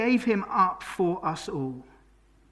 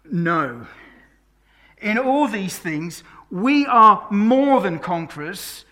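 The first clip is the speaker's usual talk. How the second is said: rising, ever louder preaching, each word slow and weighted; full-force preaching; 2 semitones higher, 9 dB louder, slower than usual. Acted. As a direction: build the list up like a call to the church. Preaching Romans eight at full force.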